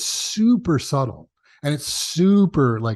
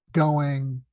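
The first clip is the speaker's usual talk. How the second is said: usual speaking voice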